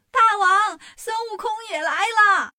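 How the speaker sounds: high, loud cry of warning; alarmed shout. A narrator's reading. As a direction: shout the alarm to your king. Lookout runs back to alert king.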